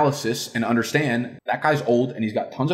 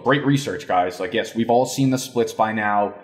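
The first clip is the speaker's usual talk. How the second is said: normal talking voice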